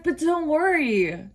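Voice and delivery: high, slow mock comfort; a staffer's hammed-up sweet reassurance; sing-song soothing. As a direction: coo "But don't worry" up high and slowly, like calming a child.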